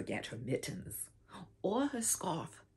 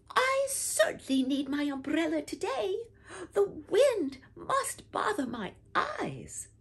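put-on high, eager little girl voice; child Momo's voice; 11 semitones higher, 3 dB louder than usual. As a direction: pipe it up like a small girl pleading. Child Momo voiced in story.

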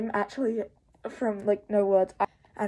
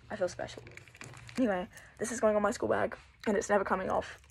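normal talking voice

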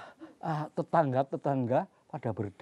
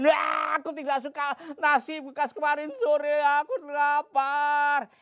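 high loud spoiled whine; whiny child; 13 semitones higher, 6 dB louder than usual. Acted. Gundul refusing the mother's leftover rice, high whine.

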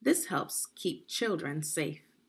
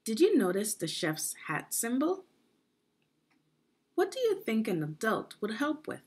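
put-on reading to children; 4 semitones higher than usual. Teacher reading recipe to children.

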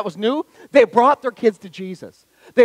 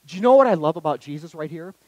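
ordinary talk

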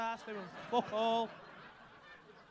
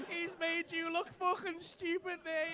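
normal talking voice

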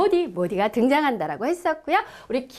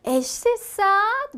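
slow model question; 7 semitones higher, much slower than usual. Shown as a demonstration. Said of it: "뭐라 그래요".

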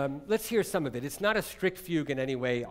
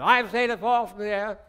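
higher and louder, laughing English toff voice; plummy Englishman; 6 semitones higher, 7 dB louder than usual. Acted. Lecturer mimics Elgar's English tone, laughing and pompous. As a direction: ham up a grand English lecturer. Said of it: "when you speak like an Englishman like that".

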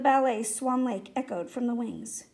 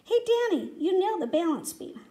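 high-pitched hey, easing down; upbeat, encouraging shout-out; cheering praise; 6 semitones higher than usual. A narrator's reading. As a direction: call out to congratulate a friend. Boy cheering on performers.

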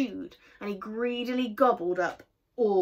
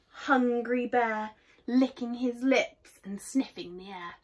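plain reading voice

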